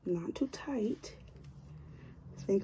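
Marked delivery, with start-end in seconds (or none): 0.1-1.2 slow whisper. Real, not acted. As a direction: whisper it carefully. Careful while styling.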